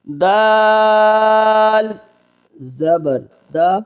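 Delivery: slow, chanted letter-by-letter reading; drill recitation. Acted. Teacher leads the class through a slow, chanted syllable drill. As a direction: recite each letter and vowel slowly for students to repeat.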